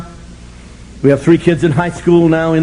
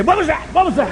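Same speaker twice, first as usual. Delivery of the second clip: paranoid son; 11 semitones higher than usual. Retold or imitated. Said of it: "He'd say".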